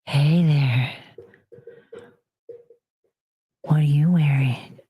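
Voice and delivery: low, breathy, seductive purr; phone sex voice. Retold or imitated. Mocking a phone sex operator to her viewers, low sultry coo. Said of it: "You just hear, like".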